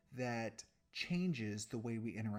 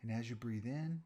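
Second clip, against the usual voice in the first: guided meditation. Real, not acted. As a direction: guide them unhurriedly through each breath, softening at phrase ends.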